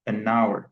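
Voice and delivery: "an hour" run together, silent h; linked pronunciation. Shown as a demonstration. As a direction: say "an hour" as "a-NOW-er", the n gliding into the vowel. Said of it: "la prononciation donne".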